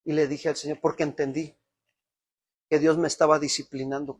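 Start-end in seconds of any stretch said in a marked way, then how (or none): none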